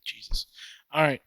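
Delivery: ordinary talk, nothing marked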